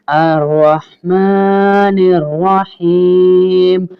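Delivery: अर्रहमानिर्रहीम with both r sounds thick and full, drawn very slowly; अर्रहमानिर्रहीम chanted slowly with full ra; heavy ra recitation. Shown as a demonstration. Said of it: "दोनों रॉ मोटे होंगे".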